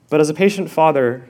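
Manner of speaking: ordinary delivery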